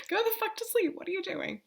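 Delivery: high-pitched, laughing mock scold. Telling Australian viewers to go to sleep, in a high mock scold. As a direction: playfully scold them like a fussy mum.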